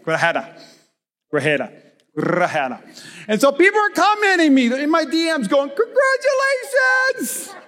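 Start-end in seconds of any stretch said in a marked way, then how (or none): 5.7-7.5 high, slow gushing congratulations; high mock congratulations. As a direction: gush it out high and slow like a fan. DMs from people congratulating.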